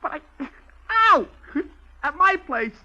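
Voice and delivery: pained and high. Acted. Joey under pain, giving in.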